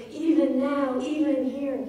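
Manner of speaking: EVEN NOW, EVEN HERE punched loud, stress on nearly every syllable; heavily stressed. Shown as a demonstration. Lecturer demonstrating the poem's stresses, loud emphatic EVEN NOW, EVEN HERE. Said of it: "More stresses compared to unstressed".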